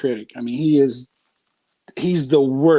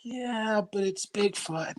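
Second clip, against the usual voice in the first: unimpressed; 3 semitones higher, 10 dB quieter, much slower than usual. Retold or imitated. Teen son dismissing dad's art.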